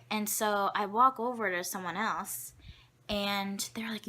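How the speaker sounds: ordinary talk, nothing marked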